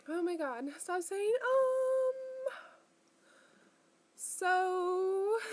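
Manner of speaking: high, loud squeal of self-annoyance; real giggly exasperation; exasperated at herself. Real, not acted. Scolding herself for saying um.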